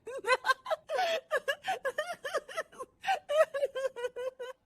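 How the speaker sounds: high shrill cackle of laughter; high giggling laugh. Acted. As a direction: laugh hard at the TV show. Daughter-in-law laughing at TV.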